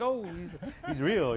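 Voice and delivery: high and slow, a mock gasp of discovery; mock surprise. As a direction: act amazed that the still dog is alive.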